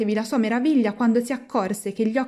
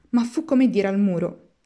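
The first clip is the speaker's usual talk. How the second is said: ordinary delivery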